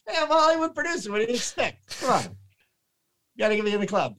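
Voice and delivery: fast, high sleazy producer voice; mock producer. Mocking a Hollywood producer, high and quick.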